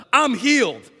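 high, loud exclamation; triumphant announcement. Acted. Man walks out of the pool announcing his cure, triumphant. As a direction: proclaim it to the whole crowd.

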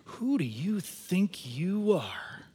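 slow, drawn-out incredulous question; stretched-out, quiet affront; disapproving look. Acted. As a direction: ask it slowly as a pointed challenge. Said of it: "they give you that look".

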